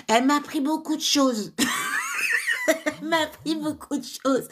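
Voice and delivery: tickled, laughing as she repeats herself. Real, not acted. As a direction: burst out laughing, then talk through it. Laughs while repeating her own remark.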